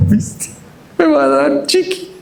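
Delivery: put-on high-pitched hopeful little child; voicing a toddler. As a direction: play the toddler's silent thoughts, up high. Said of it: "sus ojos decían todo".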